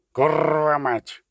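slow, rasped-out parrot curse; screeching parrot. A narrator's reading. The bird Field Marshal Duda cursing, screeching. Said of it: "заскриготав фельдмаршал Дуда".

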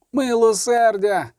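high, long-drawn plea for mercy; pleading wail. A narrator's reading. Soldier on his knees begging the witcher, high wail. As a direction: wail it slowly, pitched right up. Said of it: "завив, стоячи на колінах".

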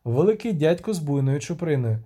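ordinary delivery, nothing marked

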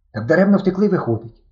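usual speaking voice

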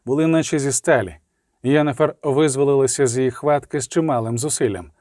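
plain reading voice

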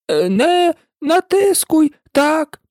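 high, slow squeaky complaint; a tiny creature squeezed and grumbling; high and slow, crushed and grumbling; Dormouse voice. A narrator's reading. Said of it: "сказав вовчок".

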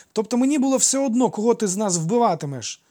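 put-on high, loud child's burst; high-pitched eager outburst; blurting out. A narrator's reading. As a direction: blurt it out like an excited boy. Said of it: "випалив він".

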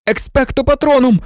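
high screaming incantation; screamed spell. A narrator's reading. Harry screaming the spell at the Dementor, shrill scream. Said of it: "заверещав Гаррі".

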